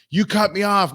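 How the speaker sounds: indignant mock complaint; mock road rage. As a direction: imitate an outraged driver, without shouting. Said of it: "road rage where people are like".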